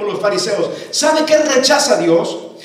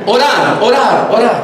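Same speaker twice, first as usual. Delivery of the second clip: orar punched loud each time; each orar louder and stressed; emphatic repetition; 3 semitones lower, 4 dB louder than usual. Shown as a demonstration. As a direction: hammer the word orar. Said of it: "repeats the correct word".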